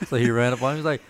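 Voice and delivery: usual speaking voice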